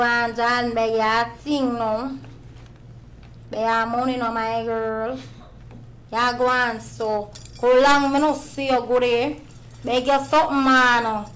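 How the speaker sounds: put-on loud, sassy downtown Kingston girl; downtown Jamaican girl. Downtown Jamaican girl accent, greeting a friend on the street. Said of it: "And then I do the downtown girl".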